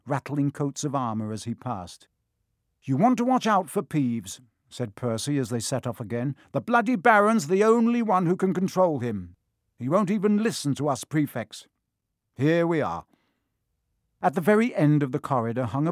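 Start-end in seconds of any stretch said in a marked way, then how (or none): 2.8-4.4 officious warning. A narrator's reading. Prefect lecturing the first years. 6.5-13.1 officious prefect. A narrator's reading. Prefect lecturing the first years.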